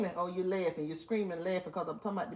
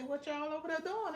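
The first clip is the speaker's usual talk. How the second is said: calling out to the chat; 7 semitones higher, 4 dB quieter than usual. Retold or imitated. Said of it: "when I say".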